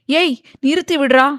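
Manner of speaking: high, loud scared yell; angry frightened shout. A narrator's reading. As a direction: scream at him to stop it. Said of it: "கோபம் கலந்த பயத்தில் கத்த". (கோபமும் பயமும் கலந்த கத்தல்)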